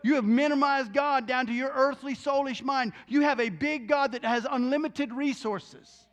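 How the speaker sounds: rising, insistent sermon voice; fervent preaching. Acted. Preacher challenging his listeners' faith, fervent. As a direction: drive the point home to the crowd.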